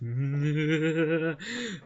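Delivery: loud, drawn-out mock villain cackle; evil villain laugh.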